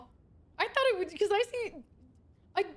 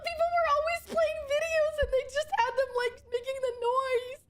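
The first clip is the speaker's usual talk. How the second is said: whiny near-tears; 9 semitones higher than usual. Real, not acted. Upset over record player.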